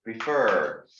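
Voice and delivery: prefer with the weight on FER; stress on second syllable. Shown as a demonstration. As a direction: punch the second half of prefer. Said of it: "intonation is in the second syllable".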